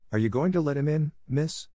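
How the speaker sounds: lightly voiced young maid, worried; Becky, anxious. A narrator's reading. Becky's nervous question.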